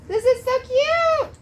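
high, loud and drawn out; gushing. Real, not acted. Mother showing a gift to viewers, gushing. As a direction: coo over the little strap.

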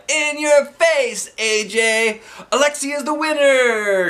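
high, loud gloating announcement; shouted. Acted. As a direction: declare the winner loudly. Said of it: "shouted Neil".

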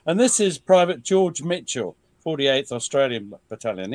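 ordinary talk, nothing marked

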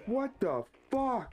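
slow, high startled cry; shocked. Real, not acted. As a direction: react in real shock. Startled by scare.

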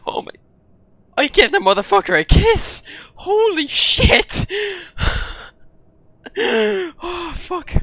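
high breathless shock after escape; shaken high panic. Real, not acted. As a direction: still squeaking with fright. Just escaped the monster.

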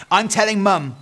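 high, loud tattletale threat; threatening. A narrator's reading. Little sister to guilty brother, threatening. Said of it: "she threatened her brother".

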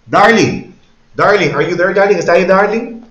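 teacher calling a student on the line. Real, not acted. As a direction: call her to check she's listening.

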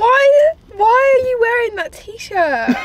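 loud, high, drawn-out wail; mock-crying exasperation; exasperated whine. Real, not acted. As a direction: protest with a slow, pleading whine. Exasperated girlfriend whining at boyfriend.

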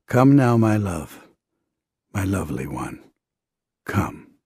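loving invitation sinking to a hush; tender call. Retold or imitated. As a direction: call out lovingly, letting each phrase fall away. Closing repeat of Abba's loving call.

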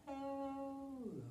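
soft, long drawn-out hello falling away; very slow hello. Shown as a demonstration. Librarian showing toddlers the slowest hello, very slow. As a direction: stretch it out as slowly as possible. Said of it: "slow as we can".

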